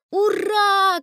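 high, loud cheer; joyful shout. A narrator's reading. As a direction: cheer the announcement. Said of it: "кричу я". (радостный громкий крик)

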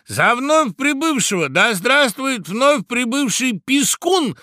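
very high, loud, slow hail; chorused welcome toast. A narrator's reading. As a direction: draw out a big cheering greeting. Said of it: "приветствовал его птичий хор".

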